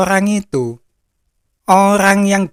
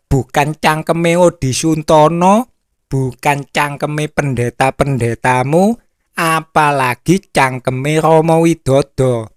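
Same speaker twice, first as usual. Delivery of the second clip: usual speaking voice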